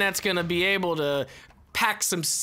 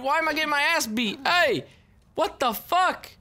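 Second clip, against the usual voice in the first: outraged yelling; 8 semitones higher than usual. Real, not acted. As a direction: shout that it's unfair. Beaten up in game, protests.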